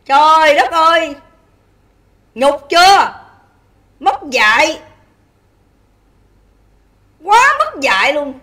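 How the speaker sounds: slow, disgusted scolding in separate bursts; real scolding. Real, not acted. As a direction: let each short rebuke land after a pause. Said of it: "Quý vị thấy tôi chửi".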